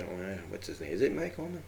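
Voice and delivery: ordinary talk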